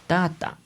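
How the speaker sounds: "Tata" high on the first TA, dropping after; TA-ta, stressed high then falling; Spanish penultimate stress. Shown as a demonstration. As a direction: punch the first syllable of "Tata". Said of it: "el español es así".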